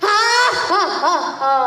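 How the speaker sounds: booming mock-giant laugh; hammed-up villain laugh; giant's laugh. A narrator's reading.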